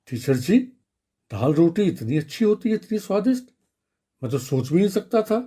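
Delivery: lightly voiced boy marvelling at his food; delighted schoolboy. A narrator's reading. Pupil tells teacher how tasty dal roti is, delighted. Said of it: "बहुत मजा आया".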